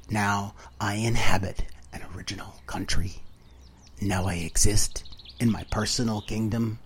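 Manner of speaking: soft whispered storytelling, intimate and close; hushed whisper. Acted. Narrator closing his cabin story, in a hushed whisper. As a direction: breathe the words out as a quiet confession.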